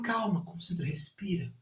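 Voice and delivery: soft, hushed reassurance; calm coaching. Retold or imitated. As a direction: talk her down quietly through the pain. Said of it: "Eu falo".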